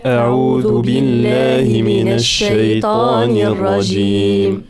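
usual speaking voice

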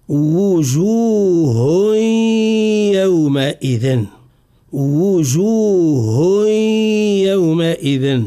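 وجوه very slow, ending in a long nasal y into يومئذ; drawn-out recitation, full w and nasalized link in وجوه يومئذ; merging with ghunna. Shown as a demonstration. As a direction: merge the end of وجوه into يومئذ with a held hum. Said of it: "يجب أن ندغمه في حرف الياء".

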